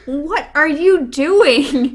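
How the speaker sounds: slow, drawn-out mock exasperation; exasperated call. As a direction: call it out loudly, stretching each word. Woman yelling from window, retold.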